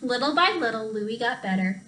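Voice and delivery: ordinary delivery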